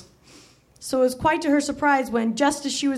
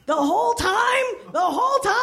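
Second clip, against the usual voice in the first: high, incredulous repeat; gasping disbelief; 9 semitones higher than usual. Acted. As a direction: echo his words in shock. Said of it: "she gasped back at him".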